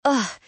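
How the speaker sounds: loud, high groan of annoyance; annoyed groan. A narrator's reading. Brother groaning about his sister, loud and high. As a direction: groan like she is a burden.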